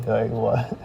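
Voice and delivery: ordinary talk, nothing marked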